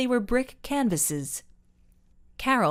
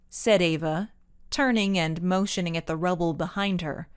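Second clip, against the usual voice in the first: plain reading voice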